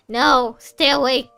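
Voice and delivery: loud, slow self-command; loud, stretched plea to stay up; rousing oneself. Real, not acted. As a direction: jolt yourself awake, drawn out and loud. Fighting off sleepiness after yawning.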